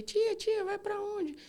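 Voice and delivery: put-on high, eager child calling his aunt; little nephew. Nephew calling to his sick aunt in the car, high child voice. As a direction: pipe up like a small boy. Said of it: "meu sobrinho veio".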